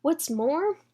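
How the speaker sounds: plain reading voice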